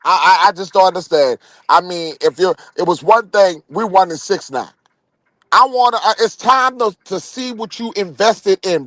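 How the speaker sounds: normal talking voice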